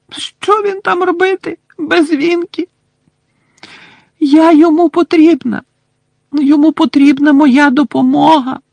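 high, loud tearful elf lament; wailing house-elf. Acted. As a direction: sob it out like a heartbroken elf. Winky wails, crying. (ридає ельфійка Вінкі)

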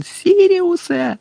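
long, very high desperate call; drawn-out high-pitched cry; yelling. A narrator's reading. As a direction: stretch the name into a high wail. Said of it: "волав він".

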